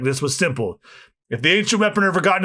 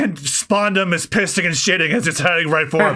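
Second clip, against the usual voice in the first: ordinary talk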